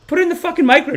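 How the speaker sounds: high-pitched outburst; retold frustrated squawk; acted exasperated demand. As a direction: demand it, voice shooting way up. Retold demand after being refused.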